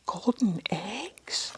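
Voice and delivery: slow whispered wonder; amazed whisper. A narrator's reading. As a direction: whisper in awe. Reader amazed.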